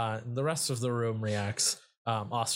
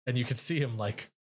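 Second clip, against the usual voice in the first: normal talking voice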